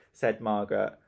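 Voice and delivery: usual speaking voice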